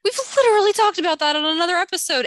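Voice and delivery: loud, high, fast exasperated protest; indignant and incredulous. Real, not acted. Co-host forgot what they discussed.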